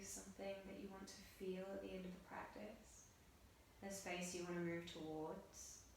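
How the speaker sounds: soft, hushed meditation guidance; guided meditation. Acted. Yoga teacher guiding students through breath on their mats, soft meditative voice. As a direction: guide the class gently into breath awareness.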